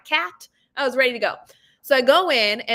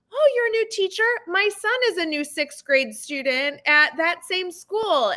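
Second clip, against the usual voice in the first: chirpy mom voice; 8 semitones higher than usual. Retold or imitated. Parent voiced in story.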